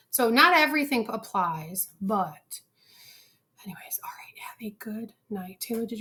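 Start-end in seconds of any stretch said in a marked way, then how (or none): 3.6-5.6 whispered sign-off. Real, not acted. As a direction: whisper goodbye so no one wakes. Signing off to sleeping house.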